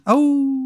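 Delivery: high imitated wolf howl. Game master imitates the howl of wolves, high and drawn out. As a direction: let out a long, high "awoo". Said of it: "the howl of wolves".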